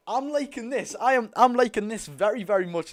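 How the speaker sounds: "I'm liking this" pitched high with bright lift; excited. Real, not acted. Streamer delighted with his team's play, excited. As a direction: say it thrilled, voice jumping up. Repeats phrase, calm then excited.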